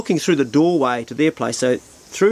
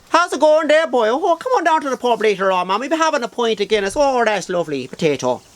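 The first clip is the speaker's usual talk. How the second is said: high, lilting Cork sing-song; hammed-up singsong southern Irish accent; 9 semitones higher than usual. Shown as a demonstration. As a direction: caricature a Wexford or Cork accent, pitched way up. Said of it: "Wexford, Cork kind of accent where they kinda go that sing-song".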